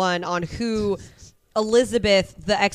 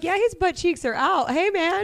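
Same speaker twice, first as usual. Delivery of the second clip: higher teasing aside; amused remark; 8 semitones higher than usual. Retold or imitated. Podcaster reacting to Martin's Speedo, teasing. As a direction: tease him lightly. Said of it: "I'm like".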